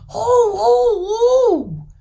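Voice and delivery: long, high, loud wail of rage; enraged troll wailing; furious howl. A narrator's reading. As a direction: howl out drawn "ho"s in fury. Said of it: "shaking and trembling with fury".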